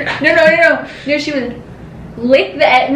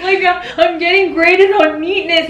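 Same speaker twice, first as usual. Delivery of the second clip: mock protesting kid; 8 semitones higher than usual. Kid objecting to mom's rice glue on artwork, protesting. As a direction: act out a kid pleading about schoolwork. Child protesting to mom, retold.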